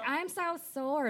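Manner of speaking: high, slow whining; whiny complaint. Retold or imitated. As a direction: whine about being sore. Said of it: "he's always like".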